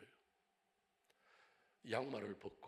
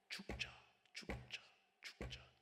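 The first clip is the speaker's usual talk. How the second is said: whispered resolve; 4 dB quieter, much slower than usual. Acted. End of motto, whispered.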